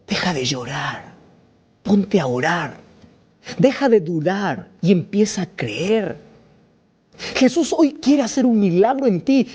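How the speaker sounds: impassioned altar call; fiery preaching. Acted. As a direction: preach it at full force from the pulpit. Preacher building to full force.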